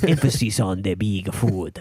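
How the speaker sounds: put-on slow French-accented pronunciation tutor; drawn-out, hammed-up French accent; mock French accent. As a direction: parody a French language video. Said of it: "a clearly French accent".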